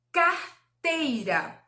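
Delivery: "carteira" slowly, broken as car / teira; slow syllable split. Shown as a demonstration. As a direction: stretch out "carteira" with a clear gap after car. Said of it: "É pronunciar devagar". ("carteira" bem devagar, separando as sílabas)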